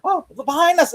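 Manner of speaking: high, squeaky mock warning; mock alarmed. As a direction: squeak it out like a cartoon sidekick. Acted quote of Lee in action scene.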